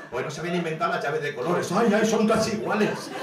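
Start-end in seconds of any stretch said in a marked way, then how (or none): 1.7-3.1 mock panic. Panicked over similar keys.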